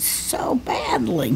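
whispered, slow teasing aside. Real, not acted. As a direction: breathe the words out slowly, teasing. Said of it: "whispered aside".

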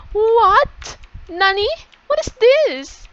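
high, loud hammed-up shock; mock astonishment. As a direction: play up amazement at a big reveal. Feigned shock at hidden passage.

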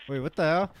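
loud high exasperation; exasperated yell. Real, not acted. Chasing escaped baby.

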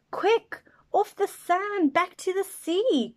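high, loud urgent little snail; snail crying out. Acted. Snail urging the beached whale back to sea, high and urgent. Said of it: "cried the snail".

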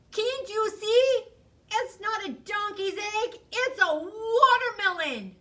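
high, loud exasperated telling-off; exasperated scolding. Acted. Hare scolding Bear about the watermelon, exasperated. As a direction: spell it out, fed up.

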